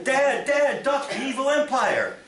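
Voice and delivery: put-on high, loud child's cry; a kid yelling mid-game; high shrill-free kid shout, full of play; excited child.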